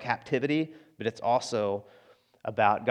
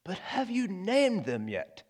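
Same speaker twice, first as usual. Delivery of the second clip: put-on old, crackly priest's voice, higher and slow, with a laugh; Richard Harris; 6 semitones higher, 5 dB quieter, slower than usual. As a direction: imitate a wise old prisoner gently teasing. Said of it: "Richard Harris in that voice says".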